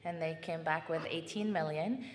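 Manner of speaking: ordinary delivery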